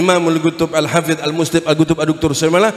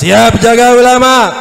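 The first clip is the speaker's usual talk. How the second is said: higher, full-force shout; loud rallying call; 7 semitones higher, 9 dB louder than usual. Real, not acted. Preacher demanding a louder pledge to guard the scholars, forceful shout. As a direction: shout it hard to rouse the crowd. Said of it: "yang keras".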